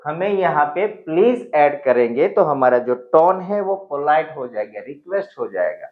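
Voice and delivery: normal talking voice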